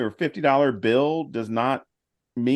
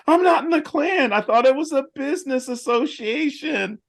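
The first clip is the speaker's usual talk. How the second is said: defensive denial voice; 14 semitones higher than usual. Retold or imitated. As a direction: deny it in a squeaky huff. Imitating Klan members denying.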